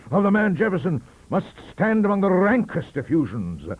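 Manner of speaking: high, loud Johnsonian outrage; pompous roar. A narrator's reading. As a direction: thunder at the vile Whig. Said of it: "he roared".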